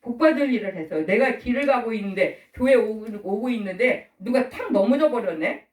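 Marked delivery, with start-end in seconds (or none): none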